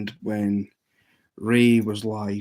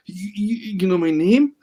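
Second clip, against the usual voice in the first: high, stammered surprise; mock astonishment, stumbling into a high question; imitating stunned disbelief; 9 semitones higher, 4 dB louder than usual. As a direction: act out someone startled at being recognised. Acting out the baffled streamer's surprise.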